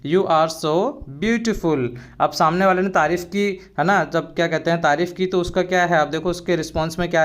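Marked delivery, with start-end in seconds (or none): 0.1-1.9 slow, carefully spelled-out English phrase; slow and clear classroom model phrase; slow teaching demo. Shown as a demonstration. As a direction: say each word slowly for the learner. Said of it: "तो कैसे बोलोगे?".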